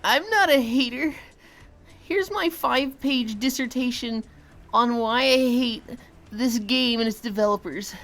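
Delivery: mock a whiny hater streamer; Euland impression. Shown as a demonstration. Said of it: "Euland impression?".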